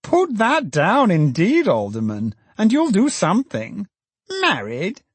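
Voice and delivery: put-on high, fussy, scandalised old economist; indignant Filer. A narrator's reading. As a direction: sputter with outrage, pitched high. Filer voiced in narration.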